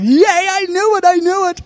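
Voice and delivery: high-pitched mock cheer, held up high; excited cheer. Preacher replays his reaction to the coming word, excited cheer. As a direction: re-enact a giddy, celebrating yay. Acts out own delighted reaction.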